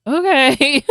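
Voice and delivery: high, loud mimicked reply; imitated puzzled okay. Partner's reply to explanation retold.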